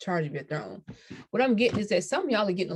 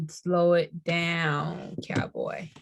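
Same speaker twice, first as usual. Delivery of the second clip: drawn-out slow; much slower than usual. Acted. Reader telling viewers to slow down, drawled slowly. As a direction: stretch it out slowly, trailing off to breath on cowboy.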